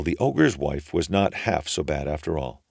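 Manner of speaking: usual speaking voice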